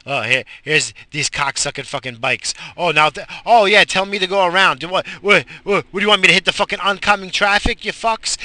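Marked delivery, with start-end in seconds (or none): 2.8-8.4 louder and higher, fast furious shouting; angry shout. Real, not acted. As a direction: yell at them in road rage. Shouting at cyclists blocking road.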